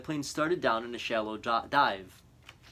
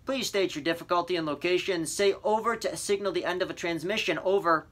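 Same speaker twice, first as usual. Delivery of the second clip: a touch higher, steady operator voice; radio operator; 6 semitones higher, 4 dB louder than usual. A narrator's reading. Operator asking the boy his difficulty, mild radio voice. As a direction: read it like calm radio procedure. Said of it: "The voice was faint and breaking up".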